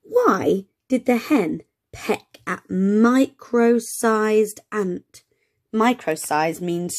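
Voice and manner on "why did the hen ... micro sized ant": slow question. Shown as a demonstration. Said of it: "have a little listen to these".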